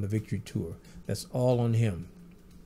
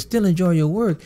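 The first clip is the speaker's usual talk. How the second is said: pitched-up Michael impression, thrilled; imitating Michael; 6 semitones higher, 6 dB louder than usual. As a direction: lift the pitch into his voice, gushing. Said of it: "He says".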